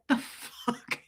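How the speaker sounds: hushed, whispered swear in mock shock; disbelieving whisper. Streamer replaying her reaction to holes in butt, disbelieving whisper. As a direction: whisper it as if too stunned for voice.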